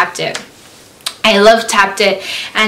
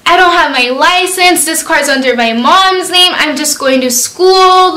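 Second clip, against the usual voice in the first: mock-crying, higher-pitched excuse; retold crying; 6 semitones higher than usual. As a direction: imitate pleading through tears. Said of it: "I was just crying".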